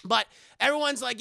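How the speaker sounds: normal talking voice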